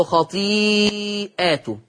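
خطيئاته with a very long drawn ī, then "ātuhu"; plural reading. Shown as a demonstration. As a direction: stretch the middle of خطيئاته slowly, plural ending. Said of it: "قرأ ورش بالجمع في لفظ". (خطيئاته بصيغة الجمع ومدّ طويل)

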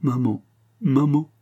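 slow, sobbing call for her mother; a child weeping in the grass, calling maman; voice broken by sobs. Acted. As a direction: cry out for mama through tears. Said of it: "d'une voix brisée par les sanglots".

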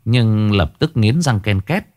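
usual speaking voice

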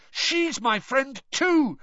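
high, slow desperate yell. Acted. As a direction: yell it out, pleading. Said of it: "Harry yelled".

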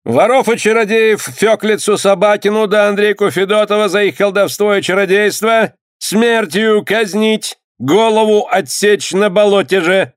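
high-pitched official proclamation; clerk reading a sentence. A narrator's reading. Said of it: "зачитал дьяк".

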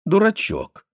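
high, lightly voiced mother teasing; teasing repeat. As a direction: toss the word off high and fond. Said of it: "повторила мама на этот раз для Малак".

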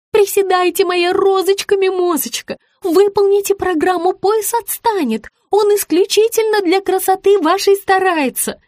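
high-pitched syrupy urging; sugary coaxing. A narrator's reading. Rita urges her client to finish squats, coaxing. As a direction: wheedle and coax in a small high voice.

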